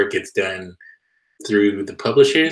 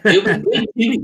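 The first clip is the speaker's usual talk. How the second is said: laughing; 11 semitones higher than usual. Real, not acted. Laughing reply to joke.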